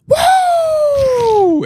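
a piercing high mock whoop, very loud. Retelling the tripper's outburst, a shrill whoop. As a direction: let out a wild whoop.

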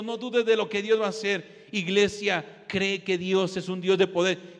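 ordinary delivery

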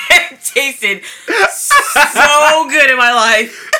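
crying with laughter; laugh-sobbing through the words; laughing hysterically. Real, not acted. Laughing-crying about pickles.

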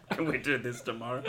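high giggling then laughing words. Real, not acted. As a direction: giggle helplessly, then speak. Laughing fit.

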